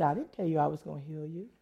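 very soft, matter-of-fact reply; quiet voice of God. Retold or imitated. God answering the woman who wanted healing, quiet correction. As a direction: imitate God gently putting her straight, hushed. Does God really talk like that?.

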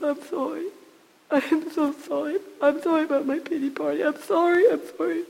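a hint of a high, tearful plea for forgiveness; surrendered apology. Woman in hospital bed says sorry to God, lightly tearful.

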